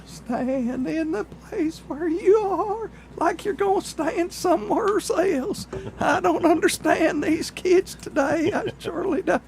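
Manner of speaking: put-on high, drawling old hillbilly; old country feller voice. Old-timer rant about kids today.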